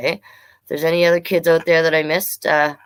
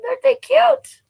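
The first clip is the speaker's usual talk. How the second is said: very high squealing coo; cutesy squeal; 5 dB louder than usual. Acted. Showing children the picture, squealing at cute loons. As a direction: melt over how cute they are. Cooing over baby birds in book.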